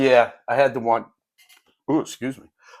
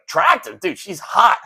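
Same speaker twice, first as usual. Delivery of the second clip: incredulous correction; 5 semitones higher than usual. Retold or imitated. Kid scoffs at teacher's mild word.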